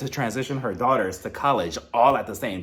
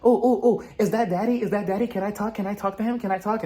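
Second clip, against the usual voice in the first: put-on high, eager little boy begging to talk; excited child voice; 7 semitones higher than usual. Child begging mom to talk to daddy, high and eager. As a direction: squeal like a kid wanting daddy on the phone. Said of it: "jumping at the phone like".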